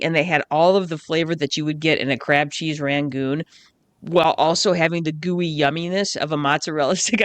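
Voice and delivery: usual speaking voice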